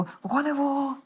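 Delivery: high, soft sing-out question; high-pitched quiet teasing; mock curious friends. Friends acted asking who he is.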